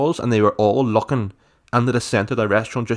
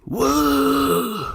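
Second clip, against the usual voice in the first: high-pitched bewildered "woo"; thin, high mock-confused "woo"; confused waiter's "woo". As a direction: mimic a baffled waiter in a high hoot. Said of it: "and he went".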